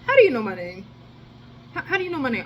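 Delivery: baffled questioning; retold confused demand. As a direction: ask in disbelief, rising. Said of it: "I was just like".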